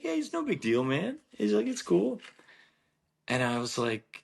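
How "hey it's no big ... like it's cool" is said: much higher, easygoing reassurance; easygoing Sergio. Retold or imitated. Sergio tells the golfer it's no big deal, breezy. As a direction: shrug it off, high and friendly.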